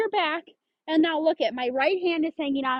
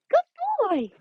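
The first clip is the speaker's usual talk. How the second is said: put-on "Good boy." pitched way up high; high-pitched praise; 12 semitones higher than usual. Shown as a demonstration. Trainer praising her dog in a high pitch. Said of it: "I give him praise at that high pitch".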